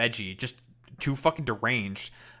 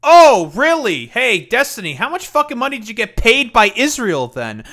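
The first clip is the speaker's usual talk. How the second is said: yelling, much higher and louder, outraged; angry shout; 8 semitones higher, 12 dB louder than usual. Real, not acted. Streamer yelling back at Destiny's clip, furious. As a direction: blast the question at him.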